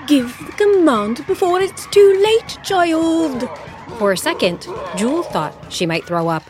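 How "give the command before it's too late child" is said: much higher and louder, pressing; urgent command. Acted. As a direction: hurry her on.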